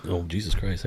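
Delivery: ordinary delivery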